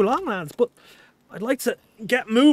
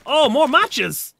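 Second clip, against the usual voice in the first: higher and drawn out slowly, pleased; excited find; 8 semitones higher, 3 dB louder, much slower than usual. Real, not acted. Streamer finding matches in loot, delighted. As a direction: savor the find like treasure.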